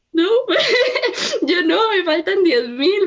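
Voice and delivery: a real laugh carrying her retold answer; laughing reply. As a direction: laugh off the bad news. Retelling her own reply to friend.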